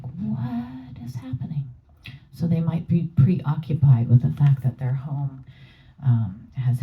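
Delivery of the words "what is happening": slow, high, breathy bewilderment; bewildered whisper. Retold or imitated. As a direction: whisper it in confused disbelief. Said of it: "they're like".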